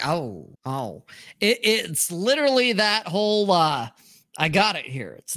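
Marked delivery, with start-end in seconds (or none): 0.0-1.0 low, soft mock "oh"; imitated sinking realization; deflated reaction. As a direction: let it drop, quietly let down.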